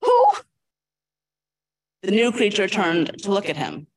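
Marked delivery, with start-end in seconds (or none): 0.0-0.3 shocked cry. Acted. Homer shocked by the butterfly.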